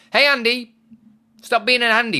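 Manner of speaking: high, loud playful yell; mock shouting. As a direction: yell it in jest.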